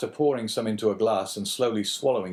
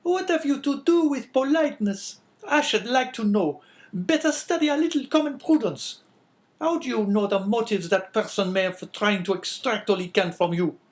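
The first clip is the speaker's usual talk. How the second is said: much higher, louder, harsh woman's voice; sickly scolding wife; 14 semitones higher, 5 dB louder than usual. A narrator's reading. Wife calling down the stairs at husband, scolding. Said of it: "retorted La Carconte".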